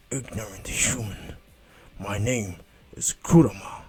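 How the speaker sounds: slow, grave demon-fox voice; solemn, drawn-out creature declaration; Kurama. Acted. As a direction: announce the name slowly and weightily. Acted fox announcing its name.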